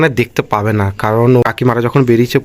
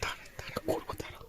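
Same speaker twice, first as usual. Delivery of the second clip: whisper; 27 dB quieter, faster than usual. Real, not acted. Sneaking near sleeping sister.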